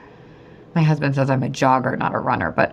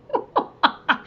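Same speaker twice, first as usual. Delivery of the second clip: light high laugh; 12 semitones higher than usual. Real, not acted. Laughs after her acted amazement.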